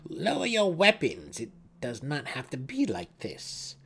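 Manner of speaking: high, firm order to lay down arms; a priestess giving an order; commanding sister. A narrator's reading. Brisa commands Drizzt.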